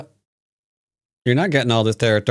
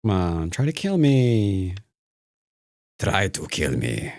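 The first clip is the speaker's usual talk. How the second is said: slow taunt; 2 semitones lower than usual. Pretend. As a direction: draw the dare out slowly.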